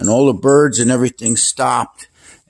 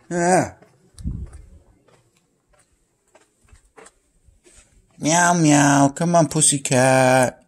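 coaxing the cat outside, raised and high; calling the cat; 7 semitones higher than usual. Real, not acted. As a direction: call her out sing-song and up high.

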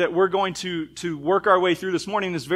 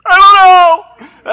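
high, loud, drawn-out crying wail; sobbing child; 16 dB louder, much slower than usual. Retold or imitated. Boy caught breaking a window cries to his dad, wailing. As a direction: wail it like an eight-year-old in tears. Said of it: "burst into tears".

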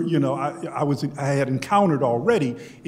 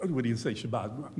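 ordinary delivery

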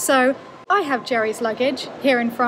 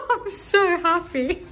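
higher, slow, laughing it out; happy laughter; 7 semitones higher, slower than usual. Real, not acted. Relieved traveller finding a bed, laughing giddily. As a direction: giggle through the words.

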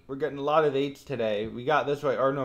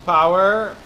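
loud shout of excitement; super loud; 3 semitones higher, 7 dB louder than usual. Real, not acted. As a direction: shout it out over the launch. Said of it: "I'm super loud".

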